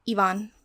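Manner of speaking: Ivan said as ee-VAHN; trying a pronunciation. Shown as a demonstration. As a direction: say Ivan with an "ee" start and open "ahn". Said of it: "I don't know how to pronounce it".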